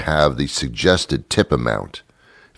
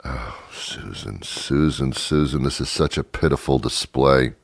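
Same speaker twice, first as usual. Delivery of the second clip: pitying; 5 semitones lower, 6 dB quieter than usual. Retold or imitated. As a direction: sigh out disappointed pity. Pitying sigh at waitress.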